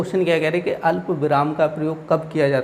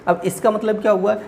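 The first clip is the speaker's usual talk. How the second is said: ordinary talk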